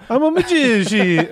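high, put-on Creole accent voice; a bad attempt at a Creole accent; Louisiana Creole accent. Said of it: "that's not a Creole accent at all".

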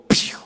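a quick cartoon "pew" sound effect; mock zoom "pew". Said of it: "and he'd go".

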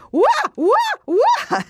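high, loud ape "wah wah" squeaks; bonobo squeak imitation. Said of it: "And he goes".

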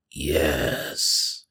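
low, soft, drawn-out creature voice; ominous creature. Acted. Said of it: "it simply says".

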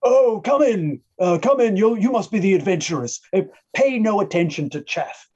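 put-on a kindly old halfling welcoming guests; lightly voiced elderly mayor. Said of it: "he says".

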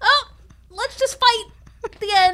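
scoffing at the film's version; mocking the movie. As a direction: parody a dumb cheerful movie line. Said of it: "in the movie, they're just like".

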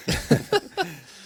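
high laugh. Real, not acted. Laughing at the trailer moment.

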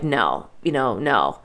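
low, soft, offhand brush-off; a hint of a dismissive man. The man finally confirming the end, low and soft. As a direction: lightly voice him turning her down.

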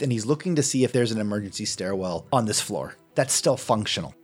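ordinary talk

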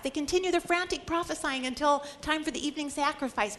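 ordinary talk

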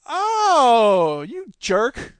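high, loud drawn-out realization; mock dawning outrage. Friends realize who Jeanette Thompson is, mock indignant. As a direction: imitate a friend just catching on to the trick.

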